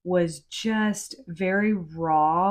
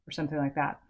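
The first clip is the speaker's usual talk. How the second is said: usual speaking voice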